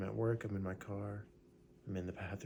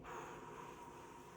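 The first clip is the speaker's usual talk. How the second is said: audible breathy sigh out; long exhale; 13 dB quieter than usual. Shown as a demonstration. Exhale during breathing exercise.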